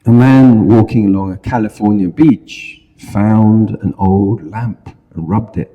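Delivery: ordinary talk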